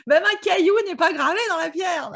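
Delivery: amused laugh over the line. Real, not acted. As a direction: giggle the words out. Joke laughed through.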